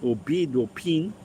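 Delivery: clipped New Zealand vowels, slow, seven, bid, pin; New Zealand accent. Retold or imitated. Mocking Kiwi vowels to a friend, light New Zealand accent. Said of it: "the Kiwis with".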